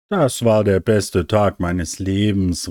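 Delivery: ordinary delivery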